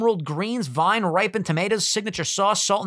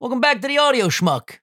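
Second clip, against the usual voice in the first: podcast host intro; 8 semitones higher, 4 dB louder than usual. Pretend.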